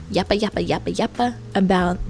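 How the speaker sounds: mock yapping, sing-song and dismissive; mocking chatter. Mocking Christians who only talk, in a sing-song yappa. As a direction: rattle off yappa yappa like empty talk. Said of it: "someone who".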